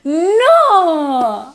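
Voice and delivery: un "no" alto y ofendido; protesta fuerte. Real, not acted. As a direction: protesta de golpe, medio en broma. Protesta en discusión amistosa. (protestando en broma contra su amiga)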